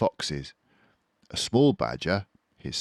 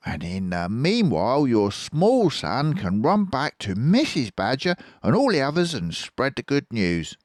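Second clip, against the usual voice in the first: much higher, louder grand fox; generous Fox voice; 8 semitones higher, 5 dB louder than usual. A narrator's reading. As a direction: invite them grandly. Fox giving plans.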